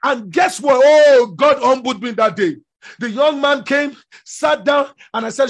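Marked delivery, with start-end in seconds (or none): none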